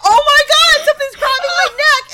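high, loud panicked squeal; hammed-up startled shriek; mock surprise. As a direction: exaggerate the shocked disbelief at a very high pitch. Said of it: "he makes a surprised Pikachu face".

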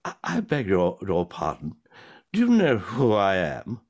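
hammed-up grand old drunk; pompous drunk. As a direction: draw yourself up in mock outrage. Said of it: "he turns to her and he says".

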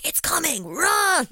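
high, loud panicked cry of a film victim; horror film panic. Retold or imitated. Warning her sister like a horror film victim, mock panicked yell. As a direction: yell it like someone fleeing a werewolf. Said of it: "in a horror film where a person changes to a werewolf and they're like".

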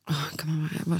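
breathy soft aside; whisper. Real, not acted. Recalling her age to a friend, whispered. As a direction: whisper it to yourself.

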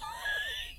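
high, breathless laugh fit; helpless laughter. Real, not acted. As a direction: collapse into real giggles. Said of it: "I don't know why I'm laughing".

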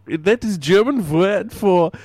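light mock Italian-German mobster accent; a hint of a thick foreign accent, deadpan; put-on Godfather accent. Caller plays a Godfather, mild mock foreign accent. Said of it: "it's probably my accent".

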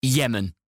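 "Yemen" as a weighted one-word answer; emphasis on Yemen. Real, not acted. Answering where it is abandoned, emphasis on Yemen. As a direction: land firmly on "Yemen". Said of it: "emphasizing the word Yemen every time".

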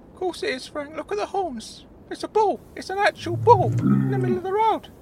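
very high character voice, alarmed; Claude. Acted. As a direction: point out danger in a squeaky high voice. Claude spots the bull.

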